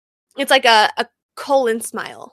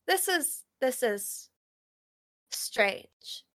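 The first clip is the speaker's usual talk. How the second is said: much softer, drifting off eerily; eerie quiet; 2 semitones lower, 6 dB quieter than usual. Acted. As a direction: fade into a strange hush. Computer getting eerie.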